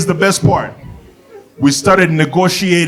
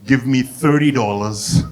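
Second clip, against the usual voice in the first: low, soft grudging offer; lower, softer official voice; 5 semitones lower, 5 dB quieter than usual. Retold or imitated. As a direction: concede it quietly and low. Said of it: "the guy was like".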